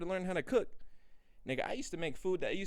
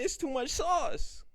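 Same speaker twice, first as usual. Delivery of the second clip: high, slow, louder brag; high boast; 11 semitones higher, 5 dB louder, slower than usual. Real, not acted. Showing off his room to viewers, high and slow. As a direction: savour the brag.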